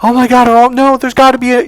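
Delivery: high, loud, hammed-up alarm; mock panic. Woman hoping for one good man, mock panic. Women's desperate realization acted out.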